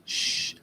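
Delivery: a soft shh to hush someone; shushing. Shown as a demonstration. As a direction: hush them with a quick shh. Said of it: "use your inside voices".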